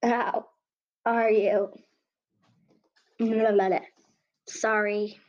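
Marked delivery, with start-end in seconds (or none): 3.2-3.8 groggy, just-woke-up voice; morning voice. Acted. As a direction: mumble it like you just woke up. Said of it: "That's just my morning voice".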